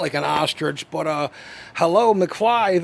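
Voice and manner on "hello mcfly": loud, slow, drawn-out hello, McFly; hammed-up wake-up call; mocking hello, McFly. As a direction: spell it out like to a fool. Mocking the ex's denial.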